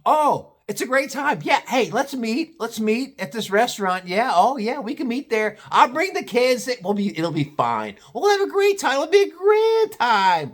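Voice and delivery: high airy mocking mom voice; mock the careless mom planning lunch; high sing-song put-on mom. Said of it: "the fucking mother".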